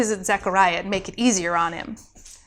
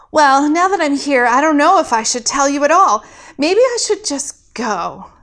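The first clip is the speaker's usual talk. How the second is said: loud, higher flustered dithering; flustered, louder nervous chatter; 4 semitones higher, 5 dB louder than usual. Acted. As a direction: talk yourself out of it. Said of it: "Alex was nervous now".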